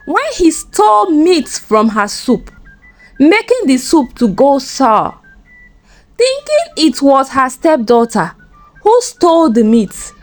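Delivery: usual speaking voice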